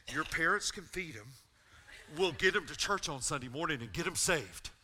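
loud, eager, rushing with excitement; excited plan. Retold or imitated. Teammate pitching a plan to Trent, loud and eager. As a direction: pitch it up and loud like a thrilled teen. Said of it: "That's how he said it".

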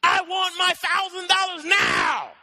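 slow, high, full-throated shout; enraged drawn-out yell; furious demand. Acted. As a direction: bellow each word at the debtor. Choking his debtor, demands money.

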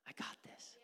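hushed whispered reassurance. Acted. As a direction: breathe it as a secret promise.

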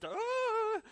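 long, loud high-pitched mock scream; screaming-goat shriek. Disciples as Jesus suddenly appears, mock goat scream. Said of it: "it's like the screaming goat moment".

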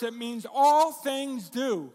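slow, with all punched first and heavy; stress on all. Shown as a demonstration. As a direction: lean on all, then let things do fall away. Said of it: "the emphasis is on all in this verse".

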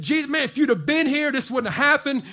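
loud, high-pitched distraught complaint; worried reproach. Retold or imitated. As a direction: act out upset mourners confronting a friend. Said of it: "They're worried. And they come up to Jesus".

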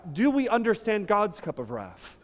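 ordinary talk, nothing marked

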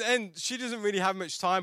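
usual speaking voice

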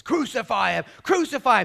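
loud, high mob chant; riotous crowd. Acted. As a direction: yell it like rioters. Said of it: "people who are becoming riotous".

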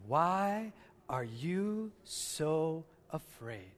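very slow, drawn-out, each word weighed; slow emphatic question. Shown as a demonstration. Pastor has church say the verse with him, slow and deliberate. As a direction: draw the question out slowly for the congregation. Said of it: "say it with me".

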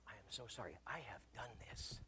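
hushed, breathy confession; barely voiced sorry; whispered apology. Confessing to wife after a fight, whispered.

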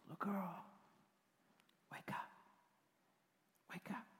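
very soft, whispered waking; sweet whisper. Acted. Jesus waking Jairus' daughter, whispered sweetly. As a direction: whisper gently to a sleeping child. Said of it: "he's just so sweet".

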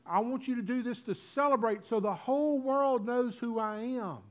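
high, driving sermon crescendo as Jesus; fervent preacher voicing Christ's command; impassioned preaching. Preaches at full force.

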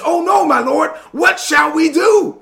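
high, loud, slow mock panic cry; hammed-up frightened servant begging his master; panicked servant. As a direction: wail it like a terrified servant. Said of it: "he starts freaking out".

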